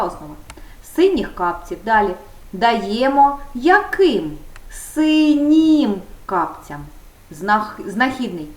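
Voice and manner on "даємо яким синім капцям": very slow, higher on the answer; slow dictation. Shown as a demonstration. Teacher dictating the case forms to pupils slowly. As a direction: dictate it syllable by syllable. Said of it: "teacher dictating forms".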